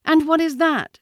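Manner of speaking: put-on high, loud boy's question; high-pitched child voice; Mowgli the boy, curious. A narrator's reading. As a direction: ask it innocently, pitched high. Boy Mowgli asks, off guard.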